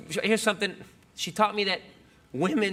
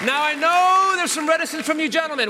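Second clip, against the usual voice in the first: booming showman; 9 semitones higher, 6 dB louder than usual. Acted. Mock preacher address to men.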